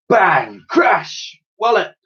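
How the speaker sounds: high, punchy exclamations; explosive comic-book bangs; crashing sound effects. Acted. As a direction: fire off each word like falling tins.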